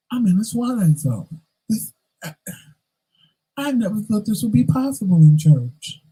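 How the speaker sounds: amazed, not believing it; stunned disbelief. Retold or imitated. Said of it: "I'm sitting there going".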